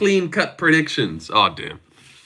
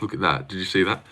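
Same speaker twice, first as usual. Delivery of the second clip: softer, lower aside to chat; quieter aside; 6 semitones lower, 6 dB quieter than usual. Real, not acted. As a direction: drop your voice for a quick aside. Softly remarking on himself.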